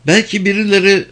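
high, fast woman's role; agitated Penelope. Acted. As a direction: pitch it up and rush the thoughts.

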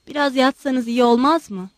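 ordinary delivery

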